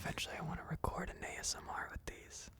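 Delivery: breathy ASMR whisper. Pretend. Playful whispered exchange.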